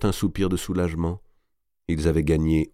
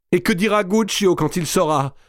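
very high, louder anguished cry; distraught question; 12 semitones higher, 5 dB louder than usual. A narrator's reading. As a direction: ask in desperate distress. Marie affolée pense à Guccio. (question affolée, voix très aiguë)

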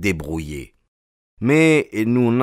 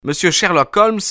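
high, louder call of the name; 11 semitones higher, 4 dB louder than usual. A narrator's reading. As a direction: call the magic name up to him. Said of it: "commençai-je".

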